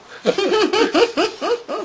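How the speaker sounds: a short real laugh, lightly high; real amused laugh. Real, not acted. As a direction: laugh at the joke of the slogan. Laughs at station slogan.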